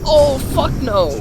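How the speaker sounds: high, drawn-out dread; mock-panicked outburst; alarmed refusal. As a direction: balk at it in a high, slow wail. Retold refusal on seeing danger.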